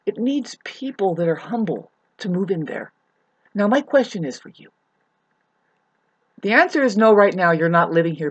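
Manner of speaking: normal talking voice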